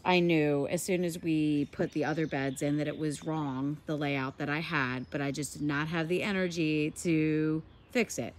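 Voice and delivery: ordinary delivery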